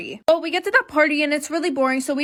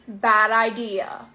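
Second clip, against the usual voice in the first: drawn-out words, dropping lower on the last two; slow, deliberate verdict; 4 semitones lower, much slower than usual. Real, not acted. Storyteller invites listeners to join in on the verdict, slow and deliberate. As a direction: spell it out slowly for the listener. Mock lesson to the audience.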